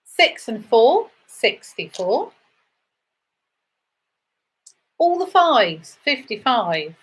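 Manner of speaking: sing-song number calling, rising then falling; bingo caller. Acted. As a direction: call each number out to the hall.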